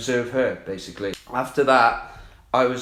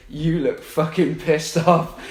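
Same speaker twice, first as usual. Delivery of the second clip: retold opener; 6 semitones higher, slower than usual. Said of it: "I think I opened with".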